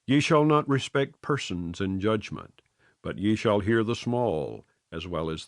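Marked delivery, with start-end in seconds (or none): none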